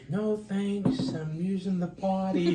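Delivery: put-on high, loud sing-song child voice; imitated little daughter. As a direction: call it out like a small girl. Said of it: "She goes".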